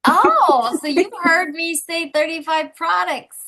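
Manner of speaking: bright dawning surprise at the mix-up; retold realization. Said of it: "And I went".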